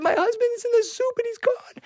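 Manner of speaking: very high, hammed-up wail; crying. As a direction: bawl it like a comic grieving wife. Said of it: "Why are you crying".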